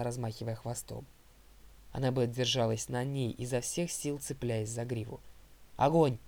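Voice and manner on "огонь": loud military-style order; command. A narrator's reading. Zoe orders the archers to shoot, commanding loudly. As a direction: call out the order, loud and raised. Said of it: "скомандовала Зоя".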